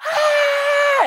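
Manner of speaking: very high, loud mock shriek; imitated little-girl scream. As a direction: scream like a scared kid on a coaster.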